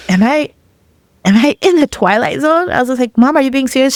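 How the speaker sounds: high-pitched, bewildered questioning; incredulous. Retold or imitated. Daughter questioning her mom's strange calm, incredulous. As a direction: lift the pitch in disbelief. Acted disbelief at mother's news.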